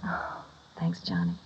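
soft unvoiced thank you; whispered thanks. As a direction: breathe the thanks out. Relieved, breathy gratitude.

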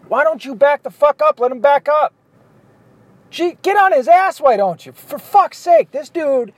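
real exasperation. Real, not acted. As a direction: berate the driver ahead in sarcastic disbelief. Annoyed at other drivers.

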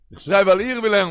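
loud, high-pitched retort; shouted answer. Retold or imitated. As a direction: shout the justification out.

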